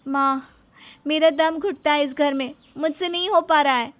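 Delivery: high-pitched, fed-up plea; distressed complaint. Acted. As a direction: pour out your suffocation to mother. Daughter complains to mother by phone.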